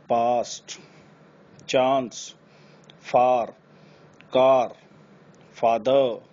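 पास्ट, चांस, फार with long open aa; long aa vowel words. Shown as a demonstration. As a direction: say each word with a long open aa. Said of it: "ये word है डांस". (लंबी आ वाले शब्द)

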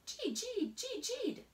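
high squeaky gee; high tiny squeaks; mouse squeak. A narrator's reading.

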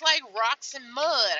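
ordinary talk, nothing marked

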